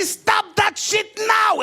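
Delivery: high drawn-out furious yell; furious order. Retold or imitated. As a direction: bark it as a furious guard. Interrogator shouts.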